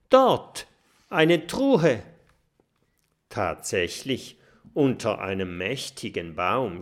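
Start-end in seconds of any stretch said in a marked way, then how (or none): 0.1-2.0 much higher, loud excited call. Acted. Oskar calling to friends on spotting the chest. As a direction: shout out the discovery. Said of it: "als Oskar ruft".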